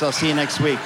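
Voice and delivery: ordinary talk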